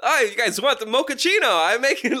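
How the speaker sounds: high put-on Borat-style accent; bad Borat accent. Host offers coffee in a bad Borat accent. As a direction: ham up a terrible Kazakh accent. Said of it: "You sound like Borat".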